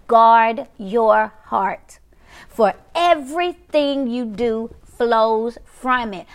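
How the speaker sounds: "guard your heart" very slow, word by word; emphatic scripture recital. Real, not acted. Preacher recites Proverbs to viewers, slow weighty emphasis on "guard your heart". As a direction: stretch "guard", "heart" and "flows", pausing between phrases.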